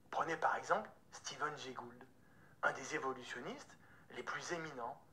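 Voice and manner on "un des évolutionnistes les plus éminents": usual speaking voice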